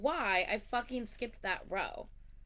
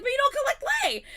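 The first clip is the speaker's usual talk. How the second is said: very high, loud, fast shout; indignant yell; 12 dB louder, faster than usual. Retold or imitated. Arguing with Allison about Lay, fast shrill indignant shout. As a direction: rattle off the accusation in outrage. Retold argument with friend.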